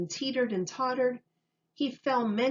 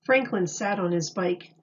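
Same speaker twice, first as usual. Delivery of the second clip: ordinary delivery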